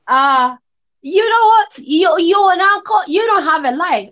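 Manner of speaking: high, loud taunting voice; mocking rude guy. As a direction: sneer it like the rude boyfriend. Retold rude boyfriend insulting.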